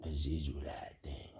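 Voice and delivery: hushed whisper of a secret; whispered aside. Real, not acted. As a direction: breathe it like a secret. Whispered aside about Israelites.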